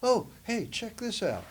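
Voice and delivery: bright eager invitation; eager invite. Retold or imitated. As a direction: pitch it up like an excited friend with an idea. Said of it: "Ricky goes".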